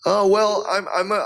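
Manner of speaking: ordinary talk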